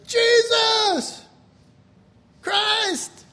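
high, emphatic shout, word by word; shouted response. Retold or imitated. Preacher translating everybody's answer to the call, shouted.

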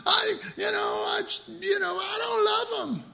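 high, whiny quote of a disgusted visitor; complaining church guy. Retold or imitated. Retelling a visitor's complaint on Bourbon Street, high and whiny. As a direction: act out a fed-up man griping about sin. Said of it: "And he says".

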